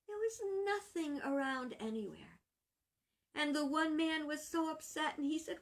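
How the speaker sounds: ordinary delivery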